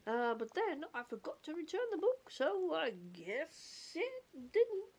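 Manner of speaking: goofy cartoon voice with stretched words. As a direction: ramble along as a hesitant cartoon character. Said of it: "goofy accent".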